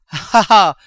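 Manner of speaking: very loud high triumphant laugh; greedy laugh. Acted. Miser laughing at the gold, loud triumph. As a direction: burst out laughing over treasure.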